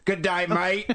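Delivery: hammed-up Aussie hello; a light Australian "Good day, mate"; Australian accent. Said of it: "that's so dead on".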